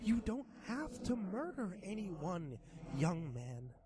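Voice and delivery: high, slow coaxing monster voice; sly creature voice. Acted. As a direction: tempt him like a grinning demon. Creature tempts boy.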